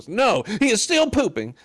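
high-pitched comic outburst; mock protesting reply. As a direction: pipe up high in mock indignation. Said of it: "And he says".

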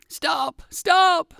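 very high, desperate yelp; crying out. A narrator's reading. Dizzy fox begs the dog to stop, crying out. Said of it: "cried one fox".

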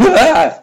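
sharp, loud gasp of surprise; startled gasp. Retold or imitated. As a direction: gasp out in shock. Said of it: "And I went".

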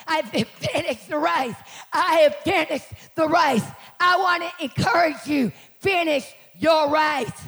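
slow, emphatic, swelling loud; full-force preaching. Real, not acted. Preacher urging the church to finish the race, forceful shout. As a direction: hammer out each phrase to rouse them.

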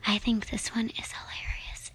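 soft ASMR whisper, no voice. Shown as a demonstration. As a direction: whisper it close to the mic. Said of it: "you're supposed to whisper".

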